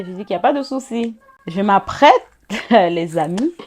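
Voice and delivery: usual speaking voice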